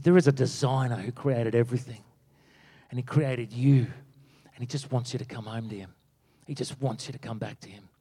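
soft, low and earnest; tender reassurance. Retold or imitated. Mentor telling preacher about the Creator, tender. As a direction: gently drop the voice, sinking almost to a hush.

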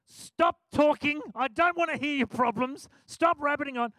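fed-up mock scold; tired, frustrated snap. Tired preacher cuts off rambling passenger, exasperated. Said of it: "tired, frustrated".

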